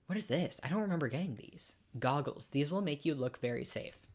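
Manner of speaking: ordinary talk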